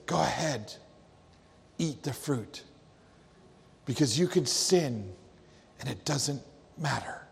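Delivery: soft sly serpent whisper in her ear; serpent hissing. A narrator's reading. Serpent hissing into Eve's ear, soft and sly. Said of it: "hisses at, in her ear".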